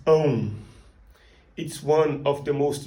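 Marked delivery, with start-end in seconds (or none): 0.1-0.5 ão said as a nasal diphthong through the nose; ão with a nasal ow glide. Shown as a demonstration. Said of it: "The sound is".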